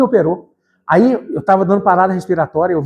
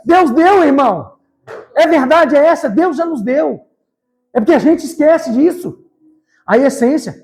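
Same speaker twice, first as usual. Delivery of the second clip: high, forceful preacher's cry; raised, stirring sermon voice; impassioned preaching; 8 semitones higher, slower than usual. Real, not acted. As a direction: proclaim it to the congregation at full force.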